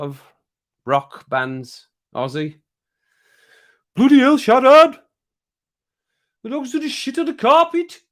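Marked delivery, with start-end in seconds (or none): 3.9-8.0 much higher, louder squeaky character voice; cartoonish high yelp of outrage; silly put-on voice. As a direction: shriek it in a comic high voice. Put-on voice, reading chat.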